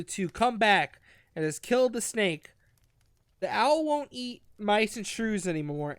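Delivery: ordinary talk, nothing marked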